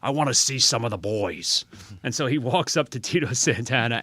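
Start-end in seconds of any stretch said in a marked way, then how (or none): 0.0-1.7 a hint of Ventura's voice; gruff-guy Ventura delivery, lightly; Jesse Ventura impression. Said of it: "and he's like".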